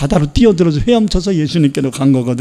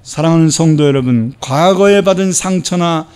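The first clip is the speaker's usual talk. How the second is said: louder, higher impassioned sermon; fervent preaching; 4 semitones higher, 4 dB louder than usual. Real, not acted. Pastor exhorting the congregation, fervent preaching. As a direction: drive the message home.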